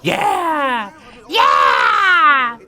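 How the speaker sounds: high, loud cheering yell; triumphant shout. Real, not acted. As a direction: scream it in victory. Cheering in game.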